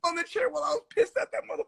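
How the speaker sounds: mock squeal. High fast comic squeal of anger.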